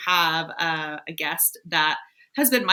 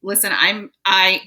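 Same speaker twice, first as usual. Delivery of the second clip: ordinary talk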